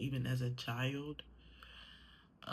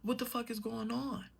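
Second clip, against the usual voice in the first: retold angry outburst; 10 semitones higher than usual. To her dad, defending her anger at God, raised high and furious. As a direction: fire it back at him, indignant. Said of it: "I said".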